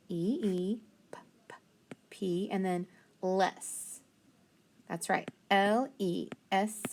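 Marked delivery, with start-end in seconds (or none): 1.1-1.5 P as a voiceless puff, no vowel; isolated p sound. Shown as a demonstration. Teacher sounding out sleepless, soft voiceless p. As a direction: puff "P" twice, very softly. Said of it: "so soul".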